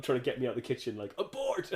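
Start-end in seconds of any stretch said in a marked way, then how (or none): 1.2-1.8 high, loud playful yell; mock shout. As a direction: shout it like calling off a mission. Mate hurrying him out, retold.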